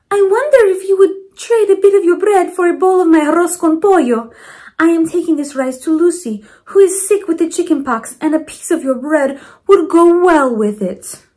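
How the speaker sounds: Mama Provi bargaining sweetly with Missus Landers; grandmother's voice. Acted. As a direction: coax the neighbour into a trade. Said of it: "Mama Provi offers a trade".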